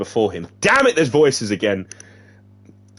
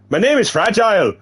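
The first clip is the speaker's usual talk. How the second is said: high, louder put-on Irish voice; badly faked Irish woman, pitched up; hammed-up Irish accent; 9 semitones higher, 4 dB louder than usual. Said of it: "I can't even do this accent".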